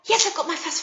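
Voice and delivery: rapid-fire run-together answer; fast voice. Shown as a demonstration. Teacher modelling the children's answer, really fast. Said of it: "Really fast".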